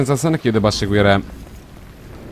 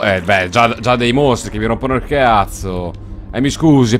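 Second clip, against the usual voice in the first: ordinary talk, nothing marked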